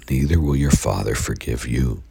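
ordinary talk, nothing marked

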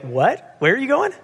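confused question leaping high at the end; puzzled listener. Acted. Congregation replying to vague directions, puzzled high-rising question. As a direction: act baffled, pitch shooting up as you ask. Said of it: "you'd be like".

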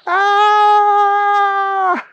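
high, loud piercing scream; blood-curdling scream. Acted. Timmy screaming as the door opens. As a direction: shriek in terror. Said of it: "let out a blood-curdling scream".

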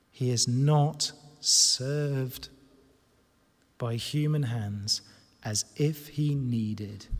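low, very slow, with long pauses; slow solemn reading. Real, not acted. Preacher reads the mind-blowing verse, slow and solemn.

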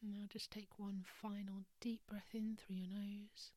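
soft whispered meditation voice; guided meditation. Acted. Leads a breathing meditation.

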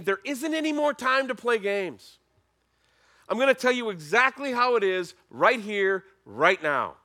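loud, high, urgent declaration; Jesus laying it down. Acted. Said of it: "this is Jesus saying".

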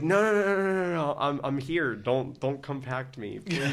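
high, loud mock-panic plea; retold frantic cry, starting high on a long run of no's; panicked pleading. Band member begging the tow truck to stop, mock panic. Waking as van gets towed.

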